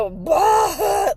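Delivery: a real choked sob; ugly cry sob. Real, not acted. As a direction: sob breaks through mid-sentence. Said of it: "it was ugly cry".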